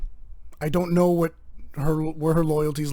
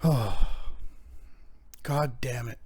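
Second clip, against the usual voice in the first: exasperated sigh; 5 semitones lower, 8 dB quieter, much slower than usual. Real, not acted. Sighing at the interruption.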